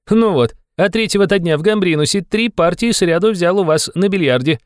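put-on high, lively young man's voice. A narrator's reading. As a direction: chat brightly as an eager youth, pitched high. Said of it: "должно быть очень молодой". (высокий голос молодого человека)

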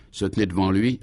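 ordinary delivery

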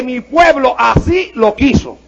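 slow, measured "Mi pueblo así lo quiso", each word set apart; deliberate emphasis. Shown as a demonstration. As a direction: spell out "Mi pueblo así lo quiso" with weighty pauses. Repeats verse to make it land.

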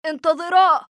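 high, urgent call from a runner; breathless shout. A narrator's reading. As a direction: shout it urgently to stop them. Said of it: "صرخ في أنفاس متلاحقة".

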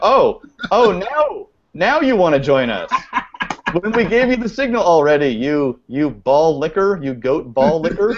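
high, indignant mock telling-off; hammed-up outrage, half laughing; mock scolding. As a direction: playfully berate the late arrival. Mock scolding of late guest.

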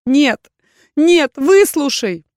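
high, loud, frantic denial; desperate shout. A narrator's reading. Heroine shouting in Erkhan's face, desperate. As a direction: cry out to be heard. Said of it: "отчаянно закричать в лицо".

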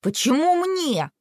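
high, drawn-out protest; outraged brother's question; indignant. A narrator's reading. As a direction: protest it, stretching the words upward. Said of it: "возмутился Макс".

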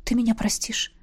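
soft whispered question; hushed, tender appeal; whispered plea. A narrator's reading. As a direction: whisper it, asking forgiveness. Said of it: "прошептал".

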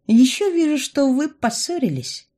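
high mystic pronouncement; reading the cards knowingly; fortune teller voice. A narrator's reading.

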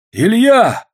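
loud, high cry of recognition; sudden exclamation. A narrator's reading. Soldier suddenly recognising Илья, loud exclamation. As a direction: call the name out high and loud. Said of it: "вдруг воскликнул кто-то из солдат".

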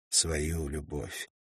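usual speaking voice

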